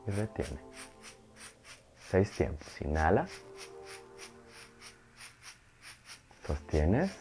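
softly pace each breath with long pauses. Acted. Counts breath cycles in meditation.

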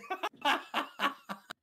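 high, rapid run of laughter; delighted laugh. Real, not acted. Host teasing a ginger guest, high cackling laugh. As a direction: burst out laughing at your own joke.

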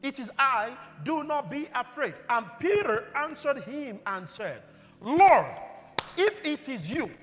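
rising, loud fervent preaching; full-force preaching. Acted. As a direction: build the congregation up with each verse.

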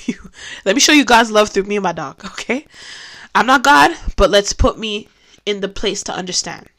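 usual speaking voice

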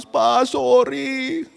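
high, slow, drawn-out mock weepy child; imitated tearful apology. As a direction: plead like a crying child saying sorry. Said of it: "dia nangis".

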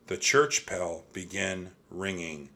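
plain reading voice

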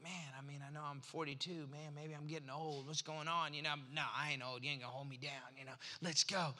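low, soft musing to himself; quiet, low inner-monologue voice; self-talk. Retold or imitated. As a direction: talk yourself round under your breath. Muttered pep talk to himself.

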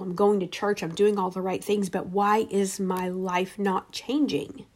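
ordinary delivery

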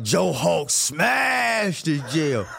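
slow, slurred Hulk smash, laughing through it; mock Hulk voice. Jailed storyteller pretends to be the Hulk, slow laughing growl. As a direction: play the Hulk breaking out, half-laughing.

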